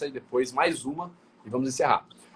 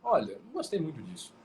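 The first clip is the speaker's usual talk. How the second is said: softer, gentle "olha, não gostei muito disso"; hint of tenderness; soft complaint; 3 semitones higher, 5 dB quieter than usual. Shown as a demonstration. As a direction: ease it out kindly. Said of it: "várias formas de ressoar a emoção".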